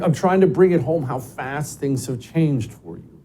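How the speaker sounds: normal talking voice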